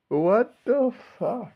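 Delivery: high, slow drawn-out what the fuck; stunned disbelief. Real, not acted. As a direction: let the disbelief stretch every word out.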